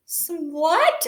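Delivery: squeaky high bunny voice asking who; little rabbit, confused; high-pitched bunny voice; high puzzled Buddy. Acted.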